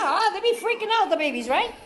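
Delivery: ordinary talk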